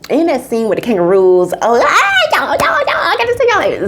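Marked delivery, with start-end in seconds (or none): 1.6-3.9 high, laughing squeals; gushing, high-pitched excitement; excited squeal. Real, not acted. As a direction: squeal with delight. Excited about kangaroo scene.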